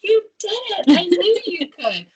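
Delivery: high, bright burst of teacher praise; excited praise. Retold or imitated. Teacher praising a proud child, high and excited. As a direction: cheer the child on, delighted.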